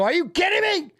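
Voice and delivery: high, incredulous "are you kidding me"; mock disbelief. Dad telling his son about the bike, high mock disbelief. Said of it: "I go".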